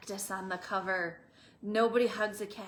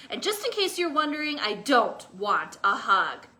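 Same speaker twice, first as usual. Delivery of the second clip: louder, fast then gruff; grumpy; 7 dB louder than usual. Acted. Cactus grumbling at the lizard. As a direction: insist you want nothing. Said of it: "said Hank".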